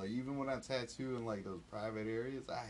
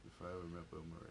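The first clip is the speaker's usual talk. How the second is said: ordinary talk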